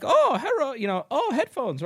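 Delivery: naive shopper. Retold or imitated. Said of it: "might just be like".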